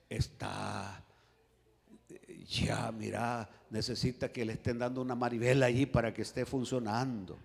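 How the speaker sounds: low, soft, grave doctor voice. Acted. Doctor puzzled by the patient's heart, low and hushed. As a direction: murmur the bad news with halting pauses. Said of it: "así me va a decir".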